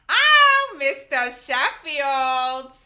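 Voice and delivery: high, loud, drawn-out New York whine; Fran Fine. Acted. Doing a New York accent for viewers, sitcom nanny impression. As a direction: stretch the name out in a big New York accent. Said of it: "my Fran Fine".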